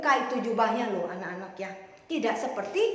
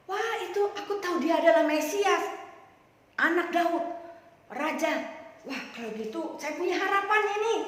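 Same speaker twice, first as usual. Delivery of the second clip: higher, eager Bartimeus; sudden hope, pitched up; surprised hope; 5 semitones higher than usual. Acted. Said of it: "si Bartimeus kaget". (kaget penuh harap, nada tinggi)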